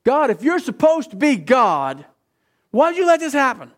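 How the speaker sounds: high, pained cry of why at God; pleading accusation. Retold or imitated. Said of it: "Have you ever asked that?".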